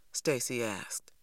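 ordinary delivery, nothing marked

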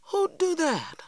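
very high, startled question; crying out. A narrator's reading. Girl reacts to danger to uncle, crying out. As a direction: cry out in alarmed disbelief. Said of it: "I cried".

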